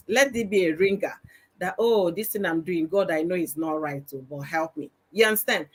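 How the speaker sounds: normal talking voice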